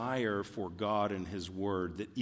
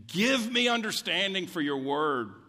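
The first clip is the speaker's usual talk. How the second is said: high, pleading cry; 8 semitones higher, 5 dB louder than usual. Retold or imitated. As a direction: plead for understanding, voice lifted. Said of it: "he is crying".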